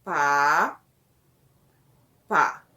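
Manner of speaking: "pá" isolado, mais grave, bem separado; sílabas de teste. Shown as a demonstration. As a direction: diga cada "pá" grave e destacado. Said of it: "Aí você fala". ("pá", pausa, "pá", sílabas de teste)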